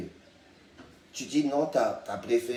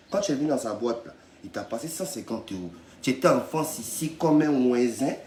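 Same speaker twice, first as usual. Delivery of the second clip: ordinary talk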